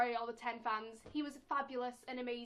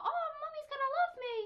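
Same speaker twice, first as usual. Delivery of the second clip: much higher, eager and proud; excited child; 14 semitones higher, 5 dB louder than usual. Retold or imitated. Four-year-old expecting mum to be pleased. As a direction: bubble with childish pride. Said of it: "I thought".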